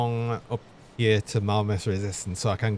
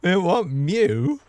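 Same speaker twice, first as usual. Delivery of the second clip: surprised; 8 semitones higher, 4 dB louder, much slower than usual. Real, not acted. Bot suddenly meows.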